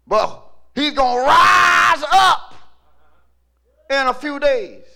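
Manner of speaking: loud, high, slow proclamation; fired-up preacher's shout; full-force preaching. Acted. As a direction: proclaim it to the congregation, stretching key words.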